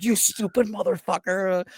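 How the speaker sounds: high-pitched imitation of a furious sergeant; high, strained retold insult; mock angry outburst. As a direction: curse him out in a high mock yell. Imitating sergeants cursing while stomping him.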